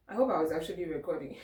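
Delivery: normal talking voice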